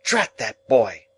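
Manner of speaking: put-on stern sister scolding the boy; irritated sister. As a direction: cut in crossly. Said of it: "interposed my sister".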